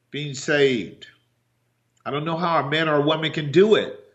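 ordinary talk

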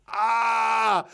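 high pained yell of Ah from a rolled ankle; reliving the ankle roll with a yelp; retold scream. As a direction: let out a sharp pain cry. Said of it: "and I scream".

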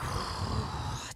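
long soft breathy exhale; demonstrated long breath out; labour breathing. Shown as a demonstration. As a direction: blow out slowly through a contraction. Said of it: "you're, like, breathing".

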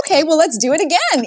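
high, bright sing-song resignation; hammed-up giving in, pitched high; mock resignation. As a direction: play the cheerfully defeated parent. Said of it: "I'm like".

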